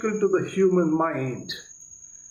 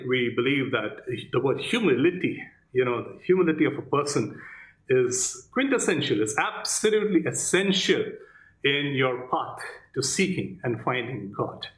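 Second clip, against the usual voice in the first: ordinary talk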